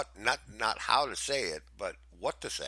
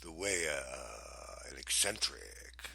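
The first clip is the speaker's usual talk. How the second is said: eccentric gentleman; 5 semitones lower, 3 dB quieter than usual. Shown as a demonstration. Co-host attempting a voice, hesitant eccentric gentleman. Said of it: "an eccentric, gentleman would speak to you".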